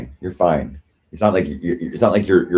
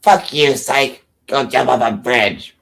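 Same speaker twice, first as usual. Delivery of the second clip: put-on hostile voice, pitched up; Christine; 4 semitones higher, slower than usual. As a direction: mimic her telling someone off. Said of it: "That's what she says".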